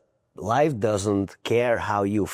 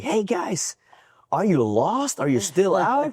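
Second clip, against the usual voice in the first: higher-pitched imitation of calling out; mild mock yell; 9 semitones higher than usual. Bar owner calling to runners from his Jeep, mock yell. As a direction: retell a yelled question, pitch lifted, volume barely raised. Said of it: "he stops and he yells at us".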